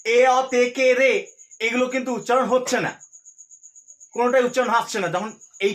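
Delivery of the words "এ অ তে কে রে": এ, অ, তে, কে, রে called out louder, one by one; teacher calling out each case ending loudly; sounding out endings. Shown as a demonstration. Teacher lists endings loudly to the class, sounding out. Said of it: "শেষে উচ্চারণ যদি বলি".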